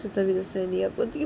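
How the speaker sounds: ordinary delivery, nothing marked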